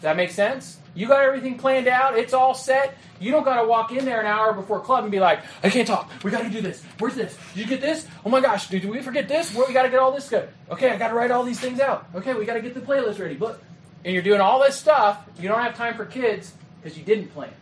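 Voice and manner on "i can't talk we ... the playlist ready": hammed-up flustered rush, pitched up; frantic, harried. Unprepared leader an hour before club, acting frantic and harried. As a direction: rattle off worries like an overloaded organizer.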